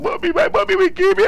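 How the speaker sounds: put-on high, panicky child's cry of dread; scared child. Boy dreading his mother's punishment, high and panicky. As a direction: voice a terrified kid fearing his mother. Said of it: "I was like".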